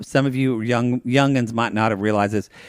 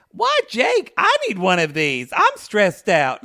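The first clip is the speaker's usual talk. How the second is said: indignant whine; 8 semitones higher, slower than usual. Retold or imitated. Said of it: "And I went".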